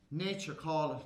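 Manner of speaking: ordinary talk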